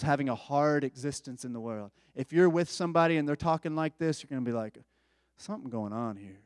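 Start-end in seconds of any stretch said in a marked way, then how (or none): 5.4-6.4 soft, wary low murmur; uneasy aside. Retold or imitated. Nervous disciple sensing trouble, hushed and uneasy. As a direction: say it under your breath, suspicious.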